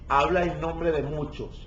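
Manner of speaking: usual speaking voice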